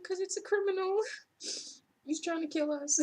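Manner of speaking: high-pitched, breaking into a laugh; laughing mock exclamation. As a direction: exclaim it incredulously through laughter. Mock exasperated answer, laughing.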